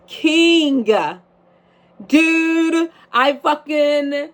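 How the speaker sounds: a high, loud burst of pride; excited shout. Real, not acted. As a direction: shout it out, thrilled. Excited about doing drag king.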